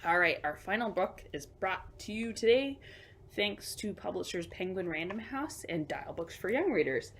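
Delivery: usual speaking voice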